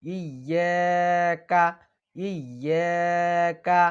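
ইয়াকা drawn out slowly with a hard doubled ইয়া; firm ইয়াকা. Shown as a demonstration. Teacher correcting students' recitation, firm pronunciation. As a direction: press ইয়াকা firmly and slowly. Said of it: "এখানে শক্ত করতে হবে".

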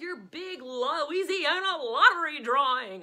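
the late news lottery show intro, in a slow booming announcer voice. Retold or imitated. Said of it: "would be like".

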